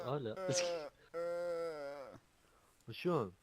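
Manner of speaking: normal talking voice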